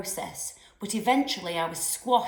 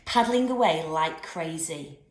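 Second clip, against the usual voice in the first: ordinary delivery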